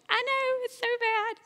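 high, soft laughing admission. Real, not acted. Preacher laughing at her own bad behavior, high and soft. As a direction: giggle through the confession.

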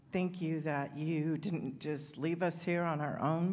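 ordinary talk

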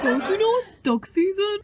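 high, loud odd voice with a laugh; goofy whale warning about ducks. Acted. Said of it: "Since you're a whale".

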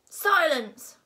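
loud, high roar. A narrator's reading. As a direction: roar for silence. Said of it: "roared Gobber the Belch".

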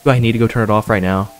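normal talking voice

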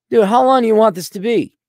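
high, loud squeal of incredulity; exasperated disbelief. Real, not acted. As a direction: balk loudly at the absurd request, way up high. Balks at an overlong listener question.

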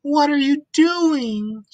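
squeaky high piglet; a curious little pig asking; high Piggy voice. Acted. Piggy asking Elephant Gerald, high and curious.

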